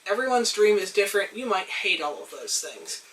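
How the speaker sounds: usual speaking voice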